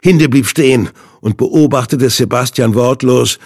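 ordinary delivery

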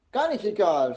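put-on angry child shouting at mum; furious shout. A narrator's reading. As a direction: shout back in defiance. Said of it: "schreit Jana und starrt Mama wütend an".

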